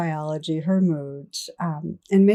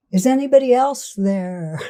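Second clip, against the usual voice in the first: higher, louder mock call into the distance; 7 semitones higher, 6 dB louder than usual. Man on cliff seeks another rescuer, calling out. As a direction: call up hopefully for someone else, hammed-up.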